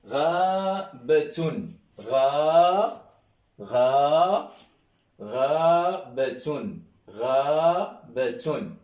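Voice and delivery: slow, with rabatun broken into syllables; slow syllable drill. Shown as a demonstration. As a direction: spell rabatun out slowly, ra by ra. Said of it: "Un exemple".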